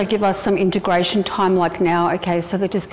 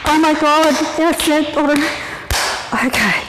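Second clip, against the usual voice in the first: ordinary talk, nothing marked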